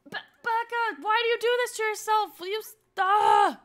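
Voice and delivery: high, loud, frustrated scolding; scold, breaking into a frustrated cry; loud exasperation. Real, not acted. Player scolding Becca for doing this to herself, exasperated. Exasperated at game character's self-sabotage.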